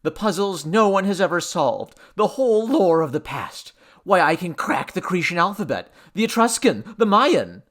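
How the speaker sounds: loud, high, eager list; exultant excitement. A narrator's reading. Man exulting over puzzles he can solve. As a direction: build with each item. Henry exults in his discovery.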